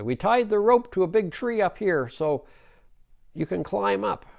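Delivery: put-on high, loud boyish shout; calling down. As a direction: shout instructions to someone far below. Boys shout down the cliff.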